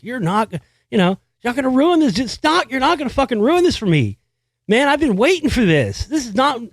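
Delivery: high-pitched indignant protest; mad at dad. Retold or imitated. Kid furious that dad spent the ticket money, mad protest. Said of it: "God, I was so mad at him".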